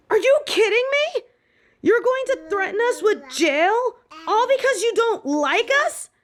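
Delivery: high, incredulous angry protest; indignant outrage. Acted. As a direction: throw the accusation back at her, outraged. Student protests unfair punishment.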